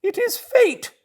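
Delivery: very high Toad voice, giving in; yielding to his craving; Toad to himself. Acted.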